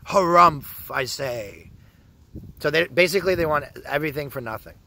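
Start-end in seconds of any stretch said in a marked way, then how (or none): 0.1-1.7 hammed-up stuffy old gentleman; mock a huffy blowhard; a haughty official clearing his throat; pompous harrumph. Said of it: "And they're like".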